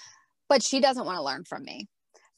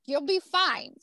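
much higher and louder, playful reassurance; mock reassuring mom; 11 semitones higher, 4 dB louder than usual. Mom telling her seven-year-old to skip math, playful high reassurance. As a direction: brush it off to a small child.

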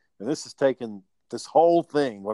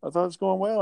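higher, mock-tearful pleading; imitating crying boss; 8 semitones higher, faster than usual. Boss hearing he's quitting, imitated higher tearful plea. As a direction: play the hurt boss, whiny and wounded. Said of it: "And she started crying".

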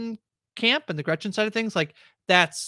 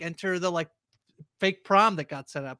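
ordinary talk

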